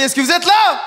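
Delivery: high, loud call to the crowd; rousing shout. Real, not acted. Comedian rousing a tired Montreux crowd, rousing shout. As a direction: shout to wake up the whole room.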